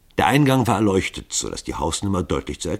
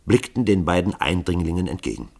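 ordinary delivery, nothing marked

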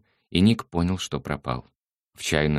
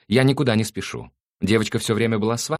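insistent mother; 8 semitones higher, 6 dB louder than usual. Acted. Mother demanding explanations.

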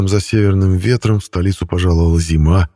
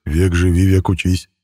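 ordinary delivery, nothing marked

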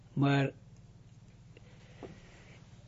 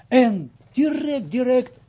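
excited; 8 semitones higher, 6 dB louder, slower than usual. Retold or imitated. Retold rush to act.

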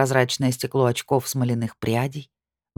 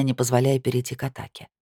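plain reading voice

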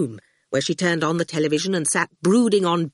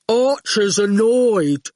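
slow, heavy-witted thug; lazy slow goon drawl; 3 semitones higher, 4 dB louder, slower than usual. A narrator's reading. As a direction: drag the words out like a dim brute. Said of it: "the goon announced".

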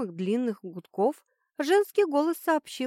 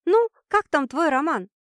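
high, louder happy chirping; cheerful chirp; 7 semitones higher, 7 dB louder than usual. Acted. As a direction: chirp it out, delighted. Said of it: "радостно прощебетала она". (радостно щебеча)

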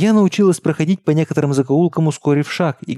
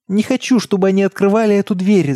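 high-pitched protest, only slightly raised in volume; frightened child's cry; 6 semitones higher than usual. A narrator's reading. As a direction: plead in a high, scared child's voice. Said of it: "закричала я". (испуганный детский выкрик, высоким голосом)

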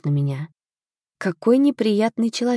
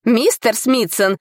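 high, slow indignant appeal; exclaiming; 6 semitones higher, 3 dB louder, slower than usual. A narrator's reading. Tutor appeals to the steward, exclaiming. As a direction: cry out in outraged complaint. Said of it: "воскликнул учитель".

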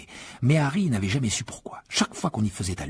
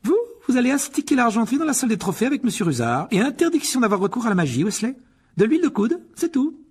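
much higher, crisp stern teacher; McGonagall; 13 semitones higher, 5 dB louder than usual. A narrator's reading. McGonagall catching the boys in the hall, stern and high. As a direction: address them like a strict professor.

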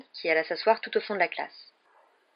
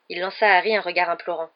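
usual speaking voice

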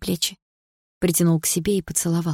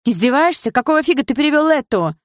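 high, loud angry demand; jealous girlfriend's loud tirade; outraged outburst; 9 semitones higher, 6 dB louder than usual. Acted. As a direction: explode at the boyfriend in high pitch. Girlfriend quarrels with boyfriend.

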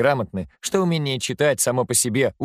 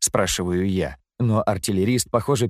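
ordinary delivery

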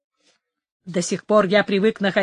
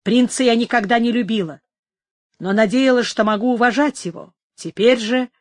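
plain reading voice

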